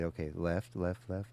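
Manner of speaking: coaching a blind reversing driver, calm and slow. Retold or imitated. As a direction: ease each left out slowly. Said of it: "and just really slowly".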